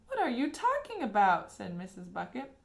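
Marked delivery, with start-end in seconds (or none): 0.1-1.5 bewildered. A narrator's reading. Mother bewildered.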